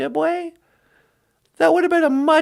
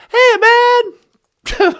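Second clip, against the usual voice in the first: louder and higher greeting; playful call-out; 6 semitones higher, 7 dB louder than usual. Acted. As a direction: shout it out cheerfully. Calling out playfully.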